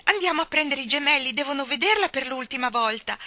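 rally her brother urgently; urgent exclamation. A narrator's reading. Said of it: "esclamò Jane".

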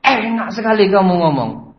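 high, loud indignant retort; acted indignation; angrier boss. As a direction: snap back at the excuse. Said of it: "tambah marah dia".

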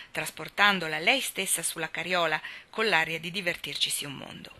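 usual speaking voice

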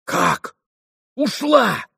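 high cry of disbelief; shocked cry. A narrator's reading. Mitya learning she has left, shocked cry. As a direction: jump up in pitch, aghast. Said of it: "вскричал Митя".

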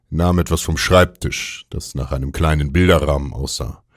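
ordinary delivery, nothing marked

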